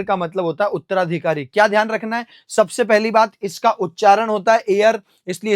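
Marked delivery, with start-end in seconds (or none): none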